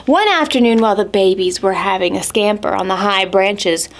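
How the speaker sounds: ordinary delivery, nothing marked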